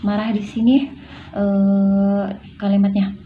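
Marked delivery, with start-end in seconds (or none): none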